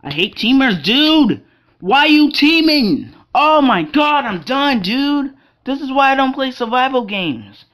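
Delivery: loud, high-pitched furious yelling; real exasperated shouting; gamer rage. Real, not acted. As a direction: yell in outrage at being ganged up on. Rage after being killed by teamers.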